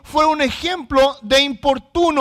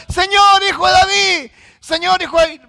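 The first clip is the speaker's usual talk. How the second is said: loud, high call over a crowd; imitated shouting; 5 semitones higher, 5 dB louder than usual. Blind men calling Jesus through the crowd, shouting. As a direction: shout it out like beggars desperate to be heard. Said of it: "ellos estaban gritando".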